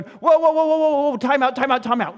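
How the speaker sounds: high, loud whoas easing into time-outs; acted flustered intervention; alarmed halting shout. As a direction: wave them off loudly, stop the crowd. Disciples stopping kids coming to Jesus.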